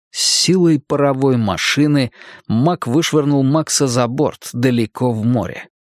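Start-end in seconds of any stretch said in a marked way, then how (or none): none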